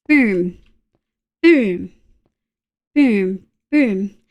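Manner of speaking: short falling booms; boom sound effects. Real, not acted. Streamer placing items one by one, falling boom noises. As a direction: punctuate each move with a boom. Voicing each placement on screen.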